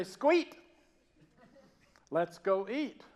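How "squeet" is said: "Squeet" in one loud, high Southern syllable; Southern accent. Shown as a demonstration. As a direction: squash "let's go eat" into Southern "Squeet". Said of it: "I slip into this accent".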